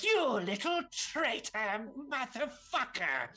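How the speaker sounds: high, slow villainous fury; Zivotraz's outrage. Acted. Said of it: "He's like".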